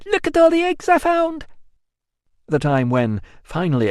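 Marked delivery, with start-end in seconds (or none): 0.1-1.4 put-on high, loud child's call; child shouting. A narrator's reading. Young Amelia shouting to the house about the eggs. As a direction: call out proudly like a little girl.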